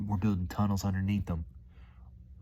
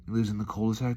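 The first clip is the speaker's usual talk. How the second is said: usual speaking voice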